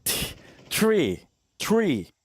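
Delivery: "tree" with a stuttered, aspirated t; stressed t in tree. Shown as a demonstration. Asking chat if they hear the t in "tree". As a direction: hit the t of "tree" hard. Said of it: "Can't you hear the t".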